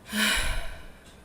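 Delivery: short open exhale; demonstrated sigh. Shown as a demonstration. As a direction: sigh out on the breath. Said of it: "right there".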